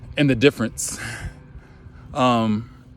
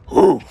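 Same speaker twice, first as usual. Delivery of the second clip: a much louder wolf-like "Whoo!"; wild whoop; 9 dB louder than usual. Retold or imitated. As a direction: let out a lion's whoop. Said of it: "like a damn wolf or a lion".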